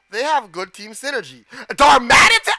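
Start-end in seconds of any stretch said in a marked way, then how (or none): 1.7-2.5 loud, higher startled yell; alarmed shout. Real, not acted. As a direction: cry out the name in alarm. Alarm at game attack.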